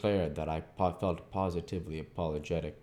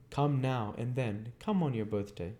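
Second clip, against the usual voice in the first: put-on old lady's invitation; 7 semitones higher than usual. Miss Havisham invites Pip.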